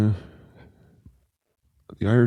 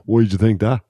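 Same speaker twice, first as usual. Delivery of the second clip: higher, louder Dublin challenge; hammed-up indignant Dublin tough; mock offended challenge; 6 semitones higher, 7 dB louder than usual. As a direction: square up at him, half-joking.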